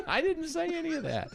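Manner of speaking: amused, laughing as the words come out high; laughing protest. Real, not acted. Co-host denying a teasing claim, laughing protest. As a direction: deny it through laughter. Laughing denial of co-host's tease.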